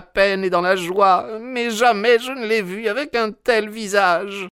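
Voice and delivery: very high woman's character voice; distraught old housekeeper. A narrator's reading. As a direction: fret anxiously in falsetto.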